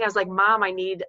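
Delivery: ordinary talk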